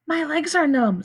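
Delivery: put-on the weak boy in the hole; a hint of a boy. A narrator's reading.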